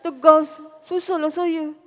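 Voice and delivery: loud, forceful mock-tough voice, unlike your own; put-on stern voice. Shown as a demonstration. Speaker jokes she can't switch to another voice, mock stern. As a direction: belt it out in a fake firm voice. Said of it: "dengan suara lain pula. Tegas".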